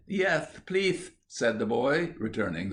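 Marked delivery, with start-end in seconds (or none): 0.1-1.1 put-on very high, childlike reply; a little child's polite acceptance; small boy. Little boy invited to play, childlike.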